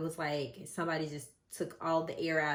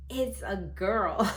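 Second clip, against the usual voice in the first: louder, slow, drawn-out announcement; doctor's announcement; 5 semitones higher, 7 dB louder, much slower than usual. Retold or imitated. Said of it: "And the doctor says".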